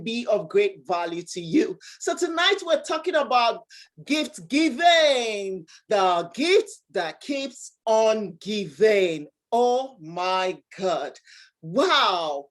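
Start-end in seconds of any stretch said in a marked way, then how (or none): 4.1-9.3 drawn-out announcement; slow and deliberate. Acted. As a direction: stretch each word out. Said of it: "host announcing the topic".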